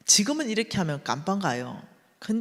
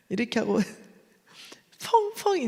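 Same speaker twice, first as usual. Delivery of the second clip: laughing; 5 semitones higher than usual. Real, not acted.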